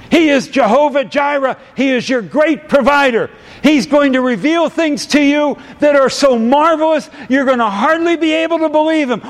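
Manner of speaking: fervent sermon shout, building; preaching at full force. Real, not acted.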